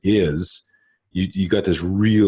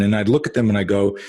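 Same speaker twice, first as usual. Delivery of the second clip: normal talking voice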